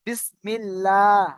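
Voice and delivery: বিসমিল্লাহ drawn out slowly, syllable by syllable; বিসমিল্লাহ chanted slowly for students; slow spelled recitation. Shown as a demonstration. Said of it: "দেখেন, বা সিন জের বিস".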